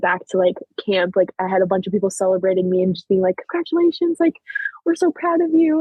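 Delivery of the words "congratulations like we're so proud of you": high-pitched celebratory gushing; imitated congratulations. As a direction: mimic a crowd of well-wishers, voice lifted high. Imitates campmates celebrating her.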